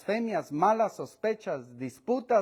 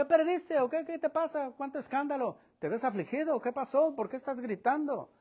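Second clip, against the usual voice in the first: higher, softer mock questions; sarcastic taunt; 5 semitones higher, 6 dB quieter than usual. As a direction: feign ignorance, needling him. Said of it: "Es manera burlona, sarcástica". (burla sarcástica, fingiendo no saber)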